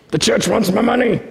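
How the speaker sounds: grumbling churchgoer. Retold or imitated. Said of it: "Here it comes".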